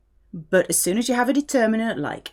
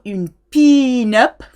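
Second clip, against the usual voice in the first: drawn-out French "pin-up", loud first syllable; French pronunciation of "pin-up", slow and emphatic; French accent; 3 semitones higher, 8 dB louder, slower than usual. Shown as a demonstration. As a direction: stretch "pin-up" slowly in French. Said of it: "saying pin-up in a French accent".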